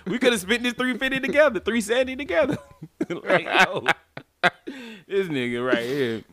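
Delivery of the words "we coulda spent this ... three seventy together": laughing complaint. Pretend. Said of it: "I'm gonna be like".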